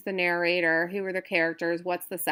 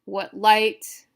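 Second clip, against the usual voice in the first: "What" unstressed, "light" higher and louder; stress on light; 3 semitones higher, 6 dB louder than usual. Shown as a demonstration. Teacher breaking the line into iambs, stress on "light". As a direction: lean on "light" after a weaker "What". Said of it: "Unstressed, stressed".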